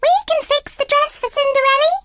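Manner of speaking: high, fast squeaky mouse; eager mouse. A narrator's reading. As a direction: rattle it off like a thrilled mouse.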